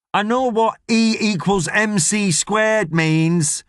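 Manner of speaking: high, slow, indignant shout. Acted. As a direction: drag out an offended retort. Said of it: "shouts James".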